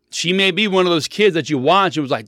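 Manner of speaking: usual speaking voice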